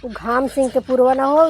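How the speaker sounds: ordinary talk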